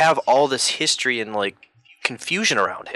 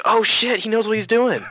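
mock shock; 10 semitones higher than usual. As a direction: gasp it high like you're stunned. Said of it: "And I was like".

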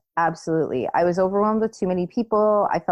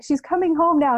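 frantic; 10 semitones higher, 3 dB louder than usual. Retold or imitated. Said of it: "trying to call Peter and say".

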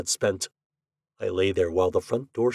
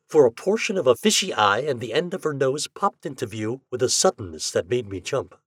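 ordinary delivery, nothing marked

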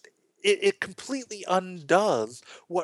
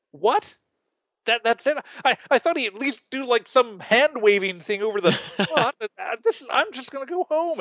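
mock indignant huff; 7 semitones higher than usual. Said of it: "the guy's angry".

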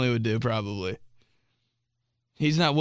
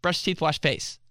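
lightly mimicked aunt, a bit higher; nagging aunt; 4 semitones higher than usual. Imitating aunt between eight and ten, lightly nagging. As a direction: toss it out like a habitual order. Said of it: "the only thing my aunt's ever said".

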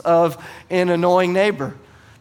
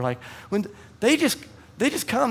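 ordinary talk

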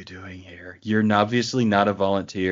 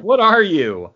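baffled mock question; 10 semitones higher, 7 dB louder, slower than usual. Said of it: "So it's like".

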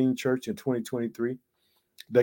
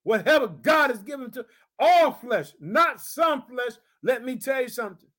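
raised, ringing preacher's voice; preaching; 12 semitones higher than usual. Acted. Pastor exhorting his congregation, full preaching voice. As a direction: preach it out to the church.

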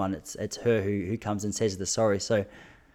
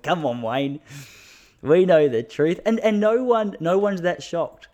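teasing scold; 7 semitones higher, 5 dB louder, slower than usual. Real, not acted. Teasing a famous footballer.

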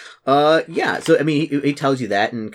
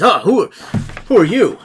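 higher, louder startled exclamation; startled paladin; 9 semitones higher, 8 dB louder than usual. Acted. Paladin meeting an unexpected visitor at the outpost, startled. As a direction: blurt it out, caught by surprise.

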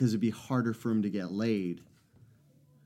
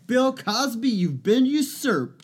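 mock proclamation; 11 semitones higher, 3 dB louder than usual.

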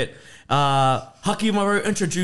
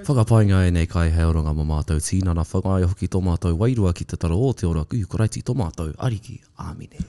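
low, quick recited grace; prayer; 11 semitones lower, faster than usual. Acted. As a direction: recite the karakia reverently. Leads grace before the meal.

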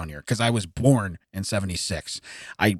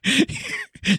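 high squealing laugh. Real, not acted. Big laugh.

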